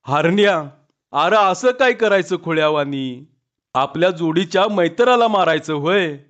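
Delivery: ordinary delivery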